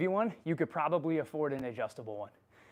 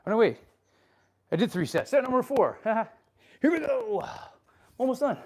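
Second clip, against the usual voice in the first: pumped up; 7 semitones higher, 5 dB louder than usual. Real, not acted. Hyping himself for next set.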